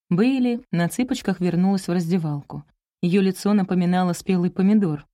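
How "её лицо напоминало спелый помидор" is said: plain reading voice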